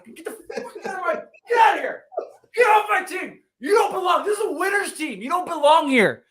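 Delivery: very high, loud ranting shout; hammed-up screaming rage at a benched player; retold furious shout. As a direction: scream him off the roster like a tyrant. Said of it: "I was like".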